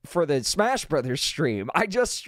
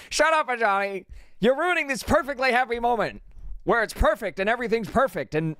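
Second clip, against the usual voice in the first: mock offended; 7 semitones higher than usual. Teasing Johnny, mock happy protest.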